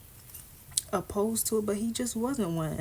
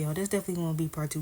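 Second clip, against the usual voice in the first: usual speaking voice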